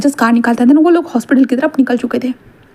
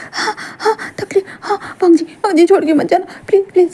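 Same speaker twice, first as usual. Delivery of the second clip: breathy pained pleading; 7 semitones higher than usual. Acted. Wing pushing, begging.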